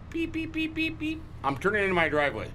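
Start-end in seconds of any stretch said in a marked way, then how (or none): 0.1-1.2 high, soft imitated backup beeps; high thin beep beep of a backing truck; reverse beeper. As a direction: mimic a truck's reversing alarm. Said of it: "I'm backing up".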